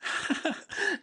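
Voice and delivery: a short, high, light laugh. Acted. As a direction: laugh fondly at her teasing. Ferdinand laughs at Miranda's teasing.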